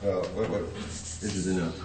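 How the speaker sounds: ordinary talk, nothing marked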